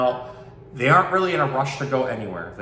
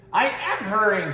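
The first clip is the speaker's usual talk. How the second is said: higher small protesting voice; tortoise protesting; 9 semitones higher than usual. Acted. Little tortoise answers the bird, mildly protesting. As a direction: insist you're already trying.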